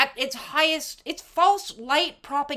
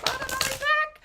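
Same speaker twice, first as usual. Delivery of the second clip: excited; 14 semitones higher than usual. Real, not acted. Showing off a new tarot deck.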